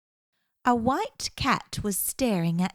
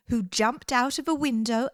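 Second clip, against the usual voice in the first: usual speaking voice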